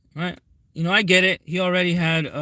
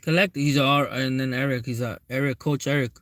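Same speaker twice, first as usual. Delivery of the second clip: usual speaking voice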